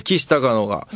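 normal talking voice

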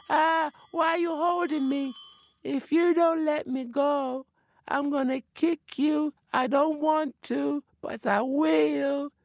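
high spirit voice, reluctant threat; a high little spirit warning it will kick; threatening forest spirit. Acted.

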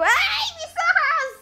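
high, drawn-out wail; mock pained cry. Streamer wailing about their eyes, high mock pained cry. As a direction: cry out as if blinded. Hammed-up pain at the glare.

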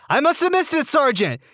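high-pitched recruit, voice dropping on "sergeant"; recruit making excuse. Acted. Recruit excusing missed fluff to sergeant, high voice.

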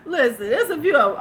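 high, loud laughter through words; laughing hard. Real, not acted. Laughing to Marlene about fillers, laughing hard. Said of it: "laughing hard".

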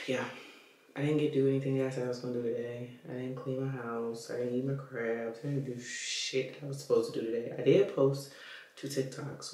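usual speaking voice

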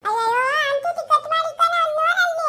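high, loud comic shrieking; shrieking comic quarrel. Acted. Comic character shrieking during the hair quarrel, high and loud. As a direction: scream it in a comic fight.

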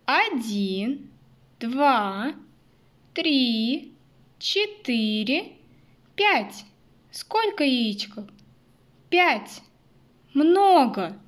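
put-on slow counting for little kids; counting with toddlers. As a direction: count aloud, building to a bright "Много". Counting eggs with children. (счёт вслух для малышей)

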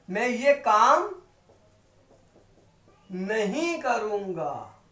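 मैं ये काम, then a pause, then नहीं करूंगा slowly; broken up for stress. Shown as a demonstration. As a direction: split it and land hard on नहीं. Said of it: "नहीं पे जोर देना है तो तोड़ के बोलेंगे". (नहीं पर ज़ोर देते हुए तोड़कर बोलना)

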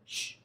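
quiet, wordless shush; gentle shush to calm a storm; soft shushing. Shown as a demonstration. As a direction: hush it softly with a "shh". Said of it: "He probably just went".

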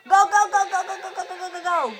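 loud, high yelling at the screen; excited shout. Real, not acted. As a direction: shout them on. Chase in game.

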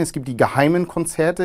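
ordinary delivery

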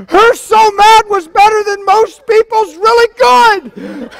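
can hardly get it out for laughing. Real, not acted. Preacher laughing with the congregation about Tammy, slow and loud. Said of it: "It was so funny".

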